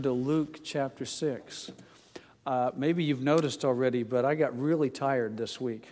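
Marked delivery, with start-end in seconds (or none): none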